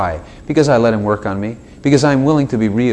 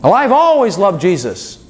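higher-pitched mock bold declaration; big bold statement; 6 semitones higher than usual. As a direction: proclaim it boldly, hamming it up. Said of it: "You just made this big, bold statement".

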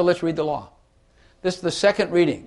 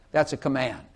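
ordinary talk, nothing marked

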